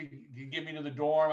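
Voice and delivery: usual speaking voice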